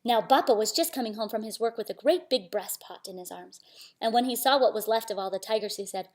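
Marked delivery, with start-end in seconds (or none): none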